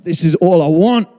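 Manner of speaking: swelling emotional confession; emotional release. Retold or imitated. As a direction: pour it out as relief. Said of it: "getting emotional".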